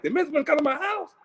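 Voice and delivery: high, fast squeak of disbelief; can't believe the news, voice jumps way up; stunned disbelief. Retold or imitated. Man retelling how he reacted to news, high fast disbelief. Retold disbelief at minister's visit.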